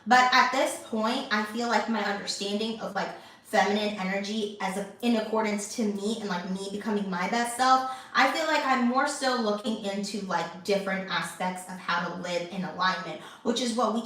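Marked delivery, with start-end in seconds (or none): none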